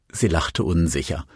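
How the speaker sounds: plain reading voice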